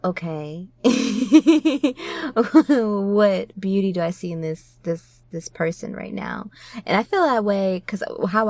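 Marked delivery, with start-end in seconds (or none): 0.7-2.3 laugh. Real, not acted. Laughing at the thought.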